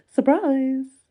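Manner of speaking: loud, high cheery Surprise!; spring it on him with jazz hands; bright, hammed-up party shout; surprise reveal.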